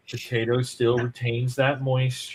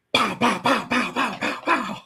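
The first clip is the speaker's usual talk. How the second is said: mock gun sounds; 8 semitones higher than usual. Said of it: "you're like".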